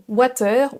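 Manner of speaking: water as wa-tair with a French A; French-accented water; French mispronunciation. Shown as a demonstration. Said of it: "Beaucoup de Français vont le prononcer".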